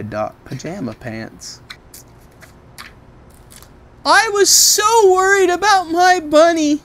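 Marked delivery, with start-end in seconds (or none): 4.1-6.8 put-on high, loud child voice; worried little girl. Sonia telling Trixie how worried she was, high and loud. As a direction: fret like a small girl. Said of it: "said Sonia".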